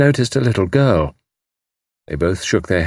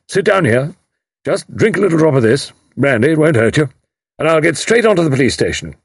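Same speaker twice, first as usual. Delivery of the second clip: higher, hearty take-charge voice; commanding major; 8 semitones higher, 3 dB louder than usual. A narrator's reading. Major sits distraught mother down with brandy, in command. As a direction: take charge briskly and reassure.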